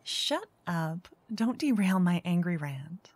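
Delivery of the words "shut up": ordinary talk, nothing marked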